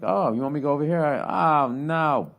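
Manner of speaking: loud, high mock cow voice; unsuspecting cow. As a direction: play a clueless cow, then sudden alarm. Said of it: "Like, the cow's like".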